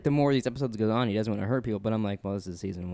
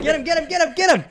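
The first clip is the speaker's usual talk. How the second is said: shouting; 7 dB louder than usual. Real, not acted. Egging on a fight.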